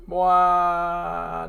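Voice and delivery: put-on a loud, drawn-out boy; a loud held exclamation. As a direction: call it out long. Voiced exclamation.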